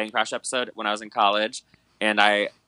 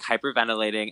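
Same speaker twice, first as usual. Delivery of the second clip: ordinary talk, nothing marked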